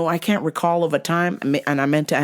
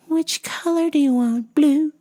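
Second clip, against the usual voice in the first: high-pitched mock coaxing; indulgent parent imitation; 10 semitones higher than usual. Parents letting a child choose a color, mock coaxing. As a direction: offer a choice in a high, coaxing voice.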